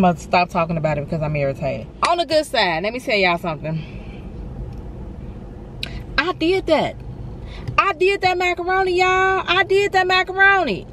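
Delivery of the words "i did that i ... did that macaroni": high, delighted bragging; proud cook showing off her macaroni; proud brag. Real, not acted. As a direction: crow about the dish. Bragging about her mac and cheese.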